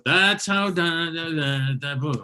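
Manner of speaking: da-da-da beats over "That's how", ending on a punchy "boo"; joke rhythm demo. Shown as a demonstration. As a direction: tap out the setup rhythm, then land "boo". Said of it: "We got the rhythm right".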